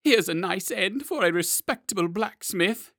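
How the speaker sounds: high, loud frightened wail; terrified lament. Acted. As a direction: wail at your own bad end. Blacksmith's lament in claw.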